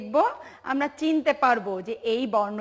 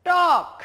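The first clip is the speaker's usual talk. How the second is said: টক called out high and loud; emphatic reveal; 6 semitones higher, 8 dB louder than usual. Shown as a demonstration. Teacher revealing the new word টক loudly to the class. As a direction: announce the word টক with a bright loud call. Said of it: "আরেকটি শব্দ কি জানো?".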